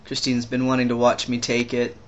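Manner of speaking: ordinary talk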